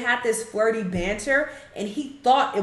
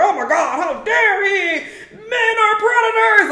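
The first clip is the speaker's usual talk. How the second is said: shrill-high mocking gasp, much higher and louder; mock outrage; 12 semitones higher, 6 dB louder than usual. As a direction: ham up the pearl-clutching. Mocking imagined outraged viewers.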